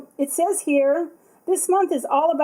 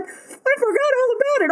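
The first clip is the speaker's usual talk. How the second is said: much higher, mock-giddy self quote; squealing self quote; 13 semitones higher, 3 dB louder than usual. Quoting her own forgetful excitement, giddy squeal. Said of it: "I was like".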